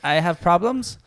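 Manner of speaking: high, loud hammed-up confession voice; mock Hitler at support group. Hitler introduces himself at a despots' support group, hammed-up and high.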